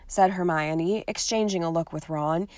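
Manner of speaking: ordinary delivery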